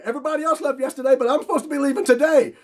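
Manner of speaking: fast and high, desperate; high pleading panic. Retold or imitated. Begging the clipboard guy to let him leave, fast high panic. Retold panic at airport counter.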